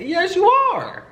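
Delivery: very high, stretched-out taunt; gleeful drawn-out insistence; teasing him. Retold or imitated. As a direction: squeal it slowly to needle him. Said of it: "how I'm kinda fucking with him".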